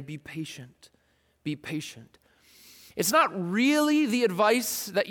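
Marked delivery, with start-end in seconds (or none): 0.0-2.2 much softer, calm counsel; gentle calm. Pretend. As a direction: hush it like a calm elder. James answers the suffering.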